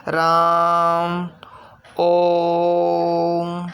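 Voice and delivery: राम and ओम with the vowel held very slowly, three beats long; extra long vowel. Shown as a demonstration. Teacher demonstrating the three-beat pluta vowel, very slow. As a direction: drag the vowel in राम and ओम out far beyond a long vowel. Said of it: "जितना समय दीर्घ स्वर में लगा था, उससे भी अलग".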